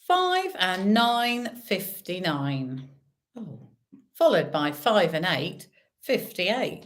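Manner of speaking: drawn-out bingo number call; bingo caller. Acted. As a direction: call the numbers slowly, rising then falling.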